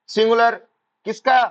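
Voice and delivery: sudden loud high call to the class; shouting. Real, not acted. Teacher shouting at his online students, loud and high. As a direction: bark the word out at the students. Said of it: "sir ऐसे मत चिल्लाओ".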